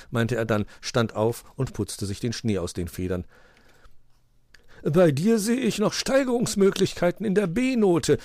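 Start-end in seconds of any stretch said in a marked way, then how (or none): 4.8-8.2 high-pitched teasing verdict; amused. A narrator's reading. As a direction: tease the skier in a raised voice. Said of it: "lachte Onkel Uhu".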